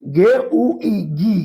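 গে উ ই গি sounded out very slowly, letter by letter; slow spelling. Shown as a demonstration. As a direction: drag out each letter of গে উ ই গি. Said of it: "দেখেন". (ধীরে ধীরে বানান করে বলা)

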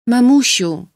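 put-on high, sleepy child's call of Mummy; little girl waking. A narrator's reading. Child waking calls her mother Mamusiu, high and sleepy. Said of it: "rzekła Helenka".

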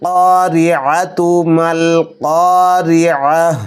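drawn-out melodic tajweed chant; slow Quran recitation. Shown as a demonstration. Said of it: "কফ এবং আইনের উচ্চারণের প্রতি লক্ষ্য করব". (ধীর সুরেলা তিলাওয়াত)

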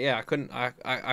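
ordinary talk, nothing marked

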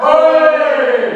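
high, loud rising Ma, oi!; hammed-up Silvio Santos call. Shown as a demonstration. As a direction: call it out like the TV host. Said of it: "E vai, entonação".

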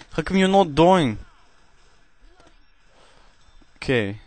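cheesy put-on Russian accent held through gameplay chat; deliberately hammy Russian accent, never dropping it. Gamer commentating to viewers in a cheesy Russian accent. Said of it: "trying to perform a Russian accent".

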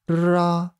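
ら with a drawn-out R, tongue tip against the palate; non-English R. Shown as a demonstration. Teacher showing R types English lacks, tongue-contact R. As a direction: stretch ら as a foreign tongue-contact R. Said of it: "舌先を口外に触らせるRの発音方法".

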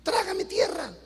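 hint of a tempted man praying for rescue; mock dismayed prayer. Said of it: "y dijo".

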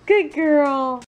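ordinary talk, nothing marked

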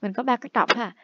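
normal talking voice

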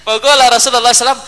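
ordinary talk, nothing marked

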